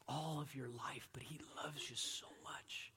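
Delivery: an intimate whispered reassurance. Real, not acted. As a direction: lean in and whisper it to them. Tender aside to the hurting.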